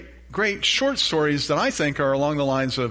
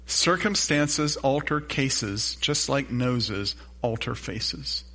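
quoting dad's saying slowly; a slow, measured family saying; much slower than usual. Retold or imitated. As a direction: recite it slowly like an old proverb. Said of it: "My dad had this thing he used to say".

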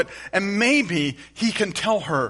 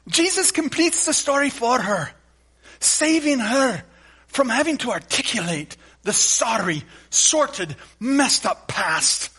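higher, put-on Scottish accent; 6 semitones higher, 3 dB louder than usual. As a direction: lightly imitate a Scottish preacher. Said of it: "with his Scottish accent".

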